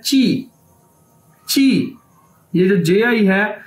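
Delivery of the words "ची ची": "ची" with a ch onset and long ee; Chinese JI pronunciation. Shown as a demonstration. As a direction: say "ची" as chee for learners. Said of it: "Chinese में कैसे बोला जाता है".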